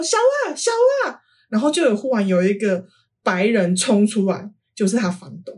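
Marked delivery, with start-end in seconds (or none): 0.0-1.2 yelling a name. Retold or imitated. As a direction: call out for your friends as if they can't see you. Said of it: "我就大叫说".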